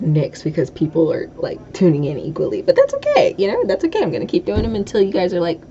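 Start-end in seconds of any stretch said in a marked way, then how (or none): 2.6-4.0 sudden high-pitched singsong reassurance. Real, not acted. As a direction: float it up high and light.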